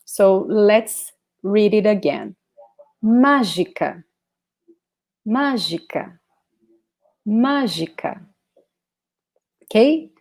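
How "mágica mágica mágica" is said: "mágica" with the first syllable MÁ stressed. Shown as a demonstration. Teacher drilling stress in "mágica". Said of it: "pronounce it as the strongest one".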